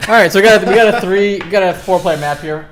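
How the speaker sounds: loud, high esports caster; game caster voice. Acted. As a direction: call the game like a live caster. Launches into casting.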